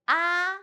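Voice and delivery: "ah," held high and level; first tone ah. Shown as a demonstration. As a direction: keep "ah," high and flat.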